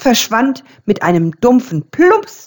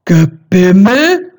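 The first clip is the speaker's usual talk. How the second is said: louder, slow outraged Gebimmel rising; indignant cry; 5 dB louder than usual. A narrator's reading. The old bell is offended by the raven's word, indignant. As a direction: spit the word back in offence. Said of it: "rief die Glaubensglocke erbost".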